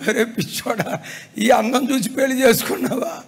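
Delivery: mock scolding a friend through laughter; laughing mock rebuke; laughing tease. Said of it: "అప్పుడు నేను అన్నా".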